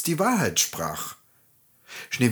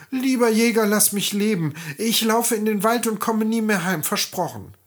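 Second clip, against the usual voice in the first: put-on high, tearful pleading girl; 11 semitones higher than usual. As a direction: beg the hunter for mercy. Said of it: "weinte das Mädchen".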